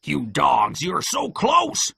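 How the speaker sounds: frustrated cry, leaping high and slow; Pew crying out. A narrator's reading. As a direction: berate the men, stretching the end. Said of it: "cried Pew".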